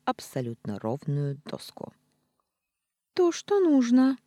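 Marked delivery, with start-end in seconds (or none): 3.1-4.2 praising. Acted. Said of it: "похвалила я".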